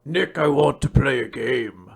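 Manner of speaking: menacing game-master impression; Jigsaw. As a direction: put on a sinister movie killer voice. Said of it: "this is what Jigsaw sounds like".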